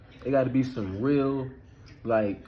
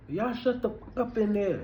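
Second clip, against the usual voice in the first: rude yell; 8 semitones higher than usual. Shown as a demonstration. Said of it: "nah, I don't like that".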